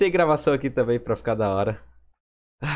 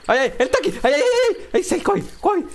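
high frantic shrieks; screaming in fear; panicked; 3 dB louder than usual. Real, not acted. Monster found again. (gritando em pânico)

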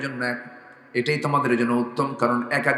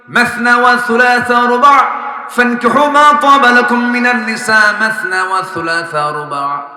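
loud, high, slow melodic recitation; full-voice scriptural chant; Quran recitation; 9 semitones higher, 10 dB louder than usual. Acted. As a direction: intone the verse with long-held syllables.

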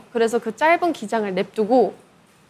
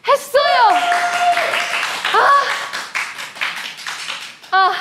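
excited shout. Real, not acted. Just finished the challenge.

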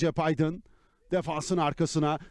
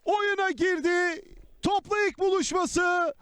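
excited football commentator; 8 semitones higher than usual. Real, not acted. After the goal, raised voice.

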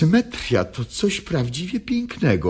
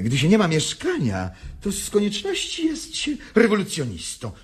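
ordinary talk, nothing marked

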